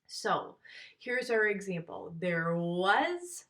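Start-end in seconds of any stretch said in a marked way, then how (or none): 2.2-3.5 "was" drawn out and rising; "There was", with a long, rising "was"; stress on "was". Shown as a demonstration. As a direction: lean long on "was", lifting the pitch. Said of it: "was is my stressed".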